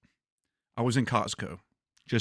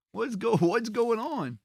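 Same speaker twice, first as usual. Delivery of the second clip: mock puzzlement; 10 semitones higher, slower than usual. Puzzled inner thought continues.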